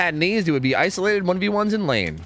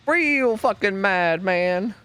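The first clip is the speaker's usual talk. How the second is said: dragged-out mock anger; 5 semitones higher, much slower than usual.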